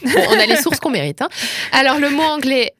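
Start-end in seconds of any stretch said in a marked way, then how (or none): none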